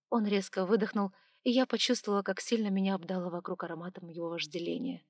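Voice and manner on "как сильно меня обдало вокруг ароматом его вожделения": plain reading voice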